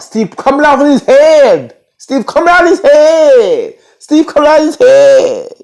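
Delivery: high, loud, stretched-out holler; hammed-up yell. Real, not acted. Telling how mad Jacob was getting, hammed-up yell. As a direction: holler each phrase and drag out the end.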